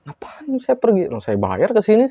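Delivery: very high, stretched opening, then settling; mock-incredulous customer complaint; joking retort. Teller jokes back at possessed woman, joking retort. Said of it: "Jadi saya bercanda".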